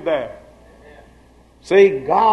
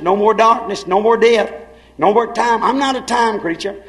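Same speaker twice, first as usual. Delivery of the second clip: normal talking voice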